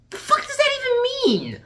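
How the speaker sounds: very high, exasperated squawk; incredulous outburst. Real, not acted. Reviewer reacting to the nonsense line, incredulous screech. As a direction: explode in baffled outrage.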